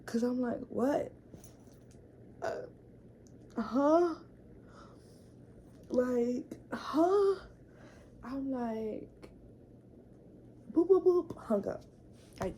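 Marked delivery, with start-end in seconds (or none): 0.7-7.3 loud, rising incredulous "huh?"; mock-stunned, higher and louder questioning; baffled disbelief. Retells own baffled reaction on phone. 10.7-11.4 high, loud "boop" noises; quick high-pitched vocal beeping; imitated phone beeps. Imitates phone buttons while hanging up.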